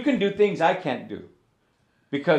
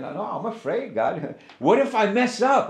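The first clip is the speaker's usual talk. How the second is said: mock afraid; 6 semitones higher than usual. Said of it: "I would say that all the time".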